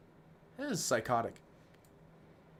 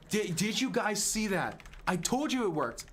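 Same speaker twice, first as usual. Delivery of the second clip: thrilled; 8 semitones higher than usual. Real, not acted. Streamer to chat after his idea works, thrilled.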